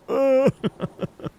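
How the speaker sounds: high giggling, still amused; can't stop giggling; high giggle. Real, not acted. Still laughing at it.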